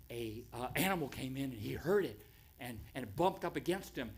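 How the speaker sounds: normal talking voice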